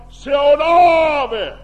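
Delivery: loud, slow terrified cry; frightened shout. Acted. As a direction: cry out in fear of a ghost. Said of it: "Ja he huutavat". (pelokas huuto)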